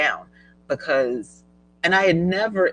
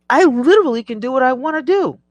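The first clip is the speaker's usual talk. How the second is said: higher, fast, gleeful burst; excited; 9 semitones higher, 4 dB louder, faster than usual. Real, not acted. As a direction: rush it out with glee, almost squealing. Bragging about freedom to travel.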